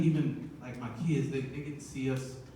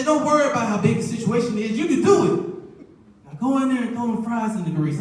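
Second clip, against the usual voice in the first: much higher, hyped encouragement; mock pep talk; 7 semitones higher, 4 dB louder than usual. Wife hyping up her husband before work, high mock pep talk. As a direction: pump him up like a coach.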